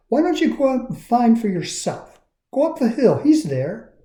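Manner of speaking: lightly voiced cheerful girl; Lucia voice. A narrator's reading. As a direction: make it sound simple and kind. Lucia answers.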